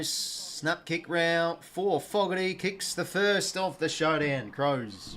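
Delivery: sportscaster energy calling the match; live footy commentary. Acted. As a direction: call the game like a TV commentator. Calling the first goal.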